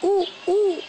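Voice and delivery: high hooting owl call; owl hoot imitation. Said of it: "Is that bird talk? Or is that owl talk?".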